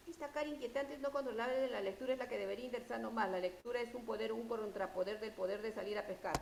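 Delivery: reading aloud far too fast, words tumbling; deliberately hurried reading with no pauses; rushed reading. Shown as a demonstration. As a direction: rattle it off so nobody can follow. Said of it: "Así no me van a entender nada".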